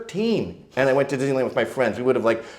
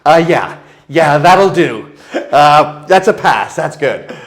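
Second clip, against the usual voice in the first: mock stunned teen; 5 semitones higher, 12 dB louder, slower than usual. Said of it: "I'm like".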